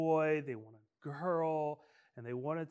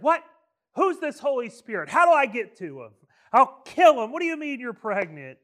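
much higher and louder, fast jealous rage; furious outburst; 8 semitones higher, 12 dB louder, faster than usual. Pretend. Joey hearing the pregnancy news, shouting in furious disbelief. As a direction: explode at the news, ready to kill. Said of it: "He said".

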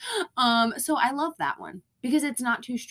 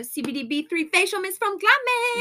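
excited together; 11 semitones higher, 4 dB louder than usual. Pretend. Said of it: "shall we say it together?".

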